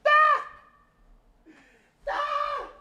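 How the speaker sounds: shrill cackling yelp, way up high; high mocking screech; mocking high shriek. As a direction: let out a taunting squeal. Mocking Giants fans.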